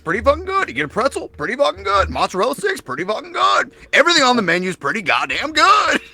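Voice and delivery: high, hammed-up impression with laughs; imitating J-Dog. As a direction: rattle off the menu in his voice. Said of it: "he goes".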